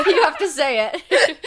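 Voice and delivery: high, loud and slow, laughing through it; laughing plea. Real, not acted. Urging her friend to do the host's line, laughing.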